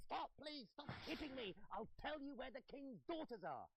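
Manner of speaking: high, loud begging voice; panicked high-pitched surrender; pleading gnome. Acted. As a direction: beg for mercy in a high squeal. Beaten gnome begging for mercy.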